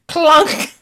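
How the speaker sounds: high, loud clunk noise; mock clunk sound. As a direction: make the coin drop sound. Imitated coin sound.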